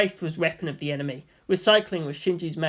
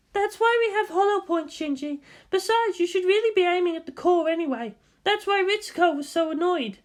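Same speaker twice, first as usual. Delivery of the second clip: high, lively woman's voice; bright teasing guardian; Misato voice; 13 semitones higher than usual. Acted. Misato questions Shinji at the target, high lively voice.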